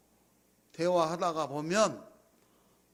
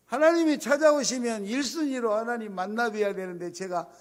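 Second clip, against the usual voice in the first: ordinary talk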